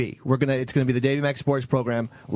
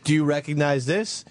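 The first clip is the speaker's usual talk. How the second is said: ordinary talk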